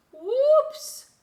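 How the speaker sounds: sudden high loud yelp; whoops. Acted. Little boat slipping on the water, high startled yelp. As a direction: yelp as you slip.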